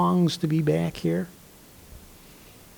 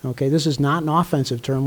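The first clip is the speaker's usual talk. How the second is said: usual speaking voice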